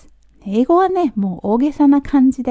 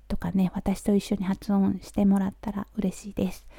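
soft whisper; 10 dB quieter than usual. Real, not acted. Instructions trail into whisper.

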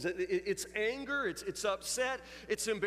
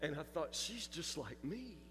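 hushed realization; 4 semitones lower, 12 dB quieter than usual. Real, not acted. Quiet moment of realization.

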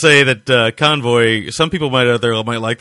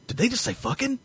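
put-on kid voice; 13 semitones higher, 7 dB quieter than usual. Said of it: "you're like".

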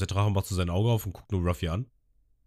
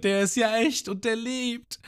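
staunend; slower than usual. Retold or imitated. Ruffy staunt über den Drachen.

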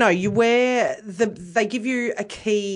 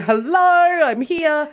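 much higher and louder hello; grand entrance; 9 semitones higher, 6 dB louder, much slower than usual. Retold or imitated. Nervous tipsy woman arriving at the venue, high and loud. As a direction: sing out an arrival. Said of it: "walked in there going".

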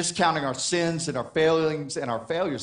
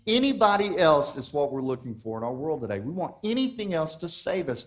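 ordinary delivery

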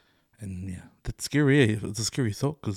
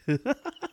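high laugh. Real, not acted. Laughing at own joke.

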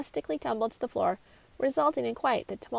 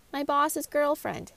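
chiming in; 5 semitones higher, 6 dB louder, slower than usual. A narrator's reading. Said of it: "Hetty chimed in".

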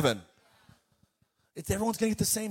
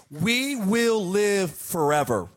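slow emphatic declaration; 3 semitones lower, much slower than usual. Acted.

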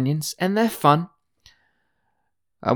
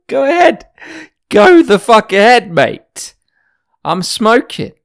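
higher, louder cocky brush-off; answering the hikers who threatened to report him, defiant; defiant retort; 9 semitones higher, 5 dB louder than usual. Retold or imitated. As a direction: dismiss them with a sneer. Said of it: "And I said".

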